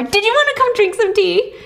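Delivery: high-pitched eager invitation; excited. Acted. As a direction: greet the neighbour in a giddy high voice. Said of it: "seems so excited to see her".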